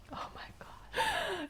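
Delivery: breathless high slow whisper; awed whisper. Real, not acted. Woman recalling the vision, breathless awed whisper. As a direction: let the awe steal your breath.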